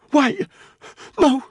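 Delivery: high, loud breathless begging; panicked plea. Acted. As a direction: beg in panic with a strained high voice. Prisoner begs for father's life.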